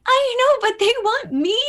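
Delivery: high, loud gleeful squeal; giddy protest. Retold or imitated. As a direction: squeal it like an overexcited kid. Agent banter, giddy imitation of herself.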